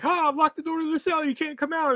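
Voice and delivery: high, quick villain's boast; mock gloating. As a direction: gloat as if the trap worked.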